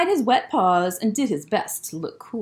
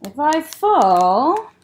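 slow, louder and higher drawn-out fall; dramatic slow fall; 5 semitones higher, 6 dB louder, much slower than usual. Acted. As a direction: stretch it out like a long tumble.

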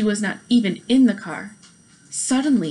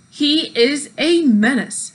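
louder, slow, pointed complaint; louder, slow grumble of complaint; a menace; 5 semitones higher, 6 dB louder, slower than usual. A narrator's reading. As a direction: stretch out the accusation. Eugenia complains about driver.

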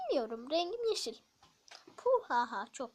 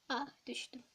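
normal talking voice

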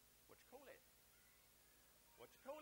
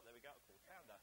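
Essex accent; 9 semitones lower, 6 dB quieter, faster than usual. Shown as a demonstration. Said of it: "Let's just make sure".

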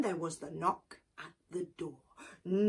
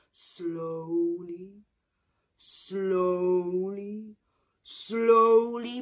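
drawn-out slowly; 6 dB louder, much slower than usual. A narrator's reading. Repeated slow walk.

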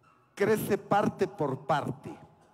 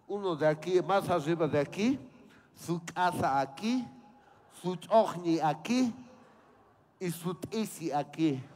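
normal talking voice

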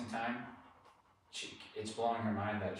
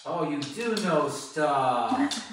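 much higher and louder, slow stretched praise; excited praise; 5 semitones higher, 10 dB louder, much slower than usual. Real, not acted. Trainer cheers Maggie for coming. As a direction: burst out with delighted praise. Dog finally recalls.

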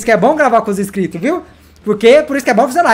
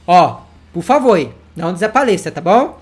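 ordinary talk

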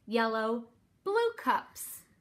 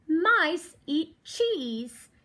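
ordinary delivery, nothing marked

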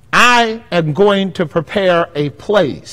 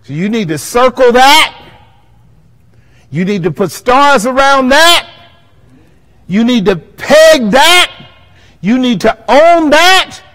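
loud, high preacher's build; preaching at full force; 8 semitones higher, 7 dB louder than usual. Acted. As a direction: drive it home to the congregation.

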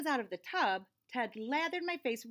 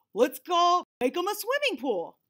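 excited Ted; 6 semitones higher, 7 dB louder than usual. Acted.

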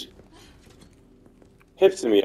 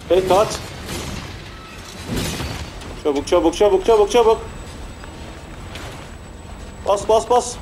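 frantic urging; 8 semitones higher than usual. Real, not acted. Player fleeing monsters and mashing the button, frantic. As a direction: push yourself to run, panicking.

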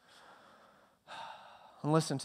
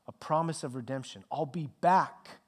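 ordinary delivery

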